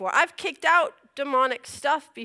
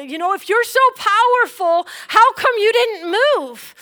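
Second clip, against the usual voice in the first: high, loud, hurt accusations; angry yelling at God; 9 semitones higher, 7 dB louder than usual. Retold or imitated. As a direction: yell your grievance at God. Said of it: "I was yelling out".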